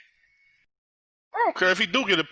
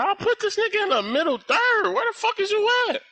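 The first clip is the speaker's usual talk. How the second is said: exasperated high yell; 14 semitones higher than usual. Real, not acted. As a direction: voice jumps way up, yelling at the screen. Yelling at teammate in game.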